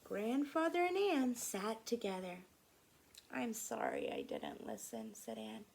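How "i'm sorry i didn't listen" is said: much lower, softer apology; Anne's voice. Acted. Anne apologizing to Grandfather, low voice. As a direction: drop it low and sorry.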